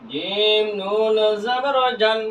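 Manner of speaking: slow recitation drill; evenly stretched syllables for learners; drawn-out spelling. Shown as a demonstration. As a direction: hold জিম and নুন long. Same spelling repeated drawn out.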